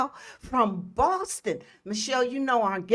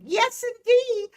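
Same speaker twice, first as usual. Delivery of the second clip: excited; 13 semitones higher, 6 dB louder than usual. Real, not acted. Excited about dance competition.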